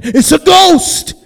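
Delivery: loud, high, drawn-out acted scream; alarmed cry. Disciples fearing something bad will happen, alarmed cry. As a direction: cry out slowly in mock terror.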